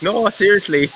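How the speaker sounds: high-pitched mock Irish accent; Irish bartender. Irish bartender insisting his accent is real, earnest Irish lilt. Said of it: "the bartender was actually Irish".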